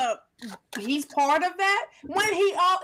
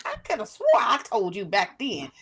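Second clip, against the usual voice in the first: lower-voiced mock mom; imagined mom's I-told-you-so; 7 semitones lower than usual. Friend imagining the mom's reaction, mock mom voice. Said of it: "she gonna be like".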